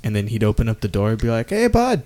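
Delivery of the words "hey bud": put-on Trailer Park Boys style invite, light and high; a goofy high Canadian offer to a buddy; mock Canadian voice. Imitating friend in Trailer Park style.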